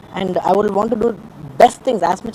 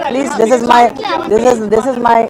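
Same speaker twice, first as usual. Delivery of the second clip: louder, urgent pleading to be understood; earnest loud plea; 3 semitones higher, 8 dB louder than usual. Real, not acted. To the reporters, begging them to understand, louder and urgent. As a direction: press it on them, begging them to believe you.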